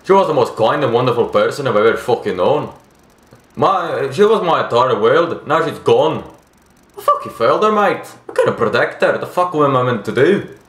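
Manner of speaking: grieving Australian character voice; Australian accent. Acted. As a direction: mourn as a self-blaming Australian husband. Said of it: "trying to do a fucking Australian accent".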